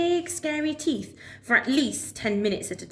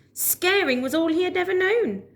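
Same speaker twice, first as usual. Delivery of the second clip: plain reading voice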